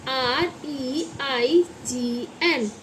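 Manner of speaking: measured classroom spelling. Shown as a demonstration. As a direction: spell it out one drawn letter at a time. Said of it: "इसको बोलते हैं".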